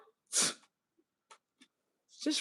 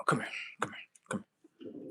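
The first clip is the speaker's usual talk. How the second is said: unvoiced beckoning whisper; 7 semitones lower, 13 dB quieter, faster than usual. Real, not acted. Calling a listener close in a whisper. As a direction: draw them in secretly.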